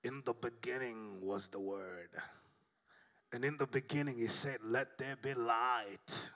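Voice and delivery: put-on low, solemn movie God with an accent; accented, deep-pitched voice of God. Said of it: "get a guy with an accent to play God".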